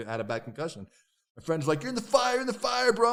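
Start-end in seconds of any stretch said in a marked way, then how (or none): 1.8-3.1 higher, louder warning to a friend; alarmed yell. Retold or imitated. Friend warns him he's in the fire with an alarmed yell.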